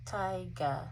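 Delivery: ordinary talk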